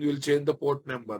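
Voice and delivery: normal talking voice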